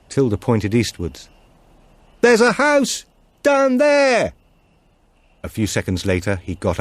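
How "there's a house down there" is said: high, loud, very slow call over the wind; shouted back. Acted. Tilda warns Martin they must leave the cars, shouting. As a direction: stretch each word so it carries through the storm.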